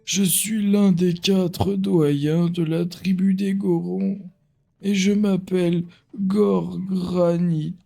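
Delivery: put-on slow, high old elder; old man voice. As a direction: read it like an ancient wise elder. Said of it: "J'essaie de faire une voix de vieux".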